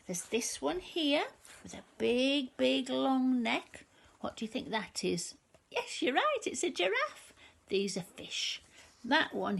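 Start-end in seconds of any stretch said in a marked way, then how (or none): none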